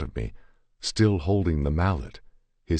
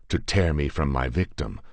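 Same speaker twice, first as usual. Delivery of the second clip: ordinary delivery, nothing marked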